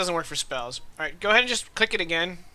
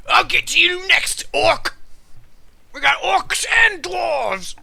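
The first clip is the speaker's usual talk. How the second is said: loud, high, drawn-out battle yell; unfazed enemy shouting; 11 semitones higher, 5 dB louder, slower than usual. Acted. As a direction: call out to your gang, slow and loud. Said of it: "doesn't seem fazed. He's like".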